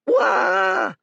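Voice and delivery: high, loud frightened cry; a boy yelping in terror; startled shriek. A narrator's reading. Village boy shrieking when Dorian says boo. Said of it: "cried the boy".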